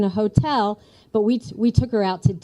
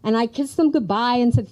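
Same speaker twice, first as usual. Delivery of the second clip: ordinary delivery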